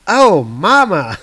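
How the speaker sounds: slow, loud disgusted drawl; disgusted groan. Acted. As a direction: gag at the stench. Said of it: "he says out loud".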